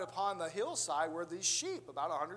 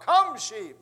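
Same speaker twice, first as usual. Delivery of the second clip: loud, raised holler mimicking a pastor; 5 semitones higher, 15 dB louder than usual. Father mimicking the pastor's voice to the sheep, hollered. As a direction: holler it with all your muster. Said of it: "he hollered out".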